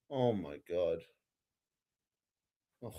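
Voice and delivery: low, soft, deflated disbelief; disgusted groan. Real, not acted. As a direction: sink into defeat. Reacting to third strikeout.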